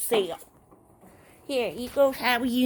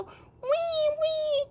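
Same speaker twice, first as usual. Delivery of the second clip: high-pitched toy weeping; pretend crying; 13 semitones higher than usual. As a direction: squeal a make-believe little "wee" cry. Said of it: "She's crying".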